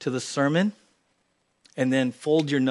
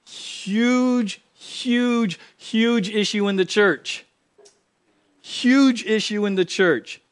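high, slow, stretched on huge; preacher hammering the point; emphatic repetition; 6 semitones higher, much slower than usual. Real, not acted. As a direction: lean hard on every huge. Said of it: "repeated for emphasis".

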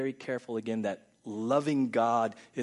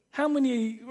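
normal talking voice

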